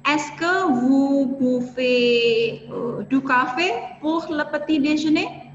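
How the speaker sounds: slow, careful reading; drawn-out French example for learners; slow French model question. Shown as a demonstration. Teacher swaps in boire for students, slow careful example. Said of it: "tinggal kita ganti saja misalnya".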